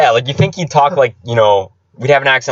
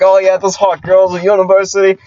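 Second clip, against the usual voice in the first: high, louder Aussie impression; Australian accent; 9 semitones higher, 4 dB louder than usual. Mimicking coworker blurting about university girls, Australian accent. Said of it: "in a thick Australian accent".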